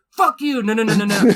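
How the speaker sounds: retold belligerent refusal; imitating a drunk friend pushing back; mock drunk defiance. Drunk fight quote.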